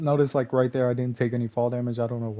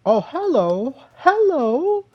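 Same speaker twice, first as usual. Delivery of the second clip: much higher, loud playful "hello" rising; sing-song greeting; 13 semitones higher, 7 dB louder, slower than usual. Retold or imitated. Gamer taunting an enemy, loud sing-song hello. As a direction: greet the enemy in a teasing falsetto. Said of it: "I s-, said".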